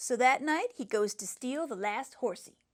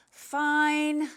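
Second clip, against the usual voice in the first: grudging; 4 semitones higher, 7 dB louder than usual. Acted. Boy gives in grudgingly.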